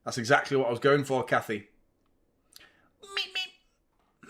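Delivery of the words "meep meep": high, soft cartoon meep meep; squeaky cartoon bird call, softly; Road Runner meep. Showing off his Looney Tunes voiceover skills, high meep. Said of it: "voiceover for Looney Tunes".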